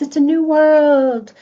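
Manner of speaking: mock excitement. Mock excited social media feed.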